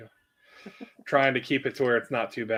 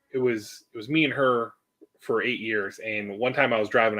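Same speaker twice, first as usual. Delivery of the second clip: normal talking voice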